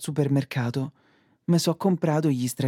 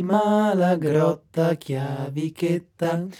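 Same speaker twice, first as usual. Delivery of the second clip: mock poem recital; 2 semitones lower, 3 dB quieter than usual. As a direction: recite like a poet, deadpan. Poem-like monologue, lower, softer.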